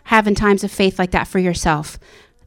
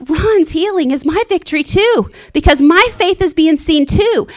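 much higher, jubilant cry to God; ecstatic praise; 9 semitones higher, 3 dB louder than usual. Retold or imitated. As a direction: rejoice out loud over a friend's healing. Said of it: "I'm like".

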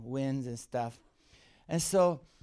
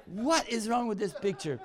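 laughing disbelief; 6 semitones higher than usual. Retold or imitated. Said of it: "And I'm going".

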